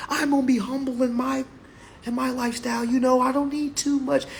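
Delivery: high, whiny imitation of a humble person; mock humble voice. Mocking a humble person who wants little.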